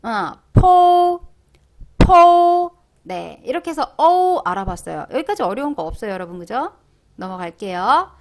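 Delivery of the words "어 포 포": 포 drawn out slow, high and loud; pinyin po demo. Shown as a demonstration. Teacher modeling the syllable for students, slow and loud. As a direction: stretch 포 out like a model call. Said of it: "포오 시작".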